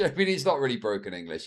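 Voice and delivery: ordinary talk, nothing marked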